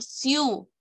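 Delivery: "siu" as one joined, drawn-out syllable; syllable demo. Shown as a demonstration. Teacher showing pupils the first syllable, syllable demo. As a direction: hold "siu" together as a single syllable. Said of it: "mi primera sílaba estará formada por".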